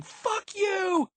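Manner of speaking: very high, screamed curse; yelling. A narrator's reading. Quendy at Violet's limp body, yelling. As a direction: yell it shrieking high. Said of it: "Quendy was still yelling".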